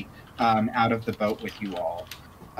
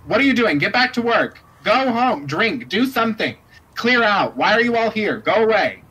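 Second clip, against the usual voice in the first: high, loud exasperated shooing; shooing the crowd; 11 semitones higher, 6 dB louder than usual. Acted. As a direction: bark at the idle crowd to scatter. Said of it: "She's just like".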